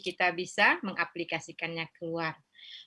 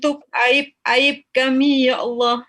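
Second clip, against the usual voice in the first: pleading prayer building from earnest to higher and louder; fervent prayer; 6 semitones higher, 12 dB louder than usual. Acted. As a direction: rise into an impassioned plea for forgiveness. Prayer rising to its climax.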